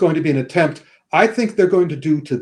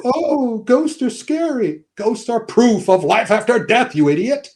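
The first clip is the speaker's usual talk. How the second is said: higher, slow, whiny mockery; mocking scaredy voice; 7 semitones higher, slower than usual. Mocking the scared characters in a slow higher whine. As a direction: parody the fearful characters, slow and high. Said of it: "They just sort of like go".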